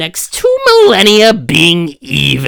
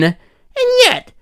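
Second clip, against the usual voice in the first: ordinary talk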